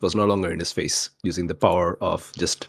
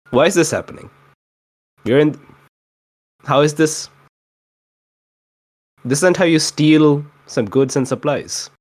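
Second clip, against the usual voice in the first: higher and louder, a touch panicked; a rattled thief who can't believe his luck; flustered confusion; 7 semitones higher, 4 dB louder than usual. Retold or imitated. GM voicing Bidjoy's thoughts mid-raid, higher and louder, flustered. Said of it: "in his head, he was like".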